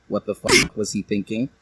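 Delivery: ordinary delivery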